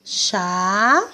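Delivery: "Chá" stretched long and rising; teacher drawing out "Chá"; separated syllable. Shown as a demonstration. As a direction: hold "Chá" as a syllable on its own. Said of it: "A gente vai separar a palavrinha assim".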